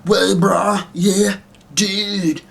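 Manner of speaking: high-pitched, updated surfer dude; bro voice. Shown as a demonstration. Puppeteer demonstrating his bro alien, high mock-dude voice. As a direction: stretch out the bro-speak up high. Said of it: "have a voice much like that".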